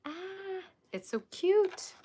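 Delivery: gushing squeal. Real, not acted. Streamer gushing over a cute gift, squealing slowly. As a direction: coo over it as the cutest thing.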